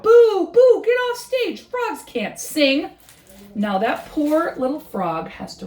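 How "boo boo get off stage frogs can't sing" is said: loud, very high booing; crowd shouting. Acted. Animals in the crowd shout at Frog, loud booing. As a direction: boo him off the stage. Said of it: "Some of them shout".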